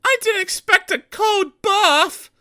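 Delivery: slow, very high rueful voice; drenched and shivering; shivering engine. A narrator's reading. Soaked Peter Sam shivering after the crash. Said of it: "he shivered".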